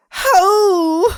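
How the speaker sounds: high, whiny nerd reading the title; nerdy voice. Retold or imitated. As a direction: squeak it out like a nerd. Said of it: "I'm gonna give him a nerdy voice".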